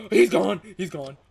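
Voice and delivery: loud burst of relief; relieved shout. Real, not acted. Player shouting in relief that the enemy left, excited. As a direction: cry out that he is gone.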